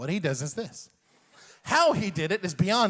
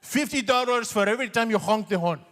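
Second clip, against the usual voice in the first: louder dad stating the fine; 4 semitones higher, 4 dB louder than usual. Retold or imitated. Dad telling his son the honking fine, lightly voiced. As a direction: insist on the rule. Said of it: "He goes".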